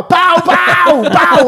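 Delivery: high, loud shouted pau; calling out. Retold or imitated. Calling the waiter across a full restaurant, shouting. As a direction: yell across the room at waiter. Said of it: "så ropar jag bara".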